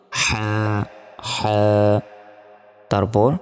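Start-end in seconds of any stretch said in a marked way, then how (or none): 0.1-1.6 হা with a pressed breathy throat sound; throaty h. Shown as a demonstration. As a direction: push হা from the middle of the throat. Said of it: "গলার মধ্যভাগ হতে". (গলার মধ্যভাগ থেকে হা)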